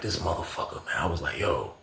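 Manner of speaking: very low, soft retold grumble; hushed exasperation. Talking to bro after the spitting, hushed exasperation. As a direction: grumble it under the voice.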